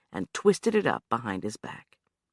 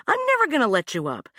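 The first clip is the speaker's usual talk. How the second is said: "never" punched high and loud, quick; stress on never; 11 semitones higher, 5 dB louder, faster than usual. Acted. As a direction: lean hard on "never". Anna refusing, hitting never.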